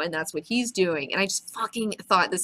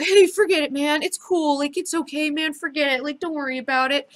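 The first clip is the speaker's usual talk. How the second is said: high-pitched, eager brushing-off; hasty reassurance; 8 semitones higher, 3 dB louder than usual. Acted. Night Owl immediately tells Rorschach it's okay, hasty reassurance. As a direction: wave the apology away in a lifted voice.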